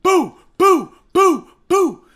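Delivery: high, loud gunshot sounds; shouted imitation gunfire; mock gunfire. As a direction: fire off the shots with your voice. Imitating gunfire chasing him.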